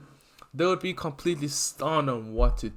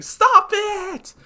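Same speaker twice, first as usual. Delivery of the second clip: anguished scream; 9 dB louder than usual. Acted. Izuku screaming at villain.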